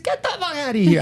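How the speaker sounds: high-pitched mock disbelief; Artie. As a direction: imitate a comic sidekick scoffing in a high voice. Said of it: "That's a good impersonation of Artie".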